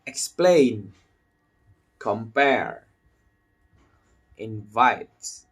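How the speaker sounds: "Explain", "compare", "invite" as ex-PLAIN, com-PARE, in-VITE; stress on second syllable. Shown as a demonstration. Teacher leading learners through verbs, second syllables stressed. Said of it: "Try this with me".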